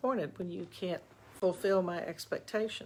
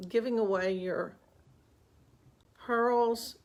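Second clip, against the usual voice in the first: usual speaking voice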